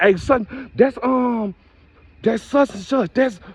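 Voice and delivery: high, thrilled hello to a viewer; excited greeting. Pretend. Meeting a viewer in real life, high excited greeting. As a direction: squeal it like you spotted an old friend. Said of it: "I'm gonna run up to you and be like".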